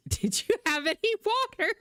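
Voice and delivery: hammed-up squeaky nag; mocking question. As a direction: needle the husband with a squeaky fake-concerned voice.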